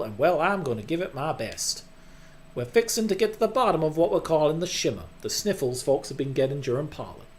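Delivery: amateur southern drawl, addressing a crowd. Acted. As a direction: give a folksy speech with a southern accent. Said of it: "you just have to do the southern drawl accent".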